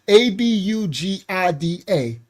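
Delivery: abugida spelled letter by letter. Shown as a demonstration. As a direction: spell out A B U G I D A one letter at a time. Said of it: "or an abugida".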